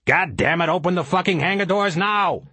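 high, urgent cursing order; angry demand. Acted. Pilot swearing at the ship's reply, angry urgent demand.

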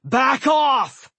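booming high shout; mighty yell. A narrator's reading. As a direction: yell it with full force. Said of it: "I heard a mighty voice yell".

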